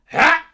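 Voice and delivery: sharp high yell "Hah!"; Link battle cry. Pretend. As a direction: shout a short heroic grunt. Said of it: "can only grunt and scream".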